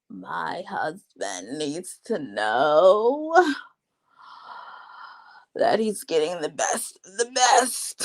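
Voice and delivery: very slow, low, drawn-out mock bride declaration; imitating tipsy bride. Mocking the drunk bride boasting about her husband, slow hammed-up imitation. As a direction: stretch every word like a self-important tipsy bride. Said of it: "She's like".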